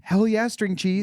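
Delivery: high, slow, drawn-out cheer; excited cheer. Retold or imitated. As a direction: savor it like a fan. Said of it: "I'm sitting at home being like".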